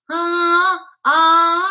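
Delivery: a high "Ah" sung through the nose; nasal ah. Shown as a demonstration. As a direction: sing ah all through the nose. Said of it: "totally through the nose".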